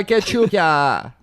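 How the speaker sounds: slow, high mock German accent; drawn-out hammy German accent. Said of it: "starts talking like a German".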